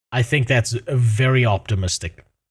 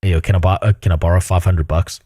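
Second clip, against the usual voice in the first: mocking tenant voice; 7 semitones lower, 5 dB louder than usual. Mocking the tenant asking for money.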